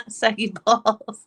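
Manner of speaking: loud, drawn-out laughing "Saggy balls"; slowed, giggling reading of a chat joke. Real, not acted. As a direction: read it out cracking up.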